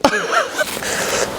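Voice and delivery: high breathy giggle; high breathy laugh. Real, not acted. Laughs at own remark.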